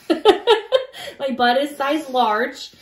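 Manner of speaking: slow, laughing through the words. Real, not acted. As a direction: laugh as you say it. Laughing at herself.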